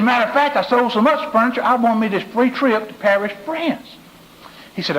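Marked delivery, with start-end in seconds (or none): 0.0-3.9 mock-excited buddy, pitched up and proud. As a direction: brag about winning the trip, voice rising high. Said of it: "he curls his mouth in a half moon".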